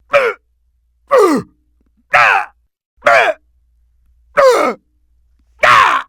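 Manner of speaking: high, loud grunts of being hit; pained grunts. Acted. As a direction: yelp at each blow. Boss taking hits.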